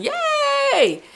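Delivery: very high reenacted cheer; high, held cheer; gleeful yelling. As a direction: stretch out a mock squealed yell. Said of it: "I started yelling".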